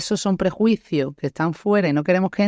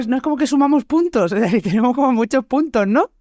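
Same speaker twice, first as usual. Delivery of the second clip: laughing; 9 semitones higher than usual. Real, not acted. Joking about stacking stereotypes.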